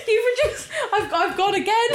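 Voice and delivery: high-pitched laughing speech. Real, not acted. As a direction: talk through helpless giggles. Still laughing.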